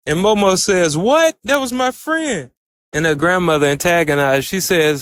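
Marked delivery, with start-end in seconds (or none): 1.0-2.5 high, startled cry of disbelief; retelling Momo's shock, pitched up high; shocked outburst. Retold or imitated. Momo shocked her grandmother killed her friend, high startled disbelief.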